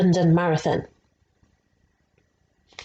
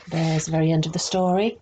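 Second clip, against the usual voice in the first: ordinary delivery, nothing marked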